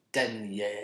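Danielle in French, stress on the second half; French name pronunciation. Shown as a demonstration. Guest trying his own name in French, French pronunciation. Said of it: "Is mine".

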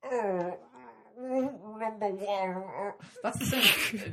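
hammed-up weepy Captain Picard; high, drawn-out mock sob of a captain; Picard crying impression. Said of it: "What would that sound like".

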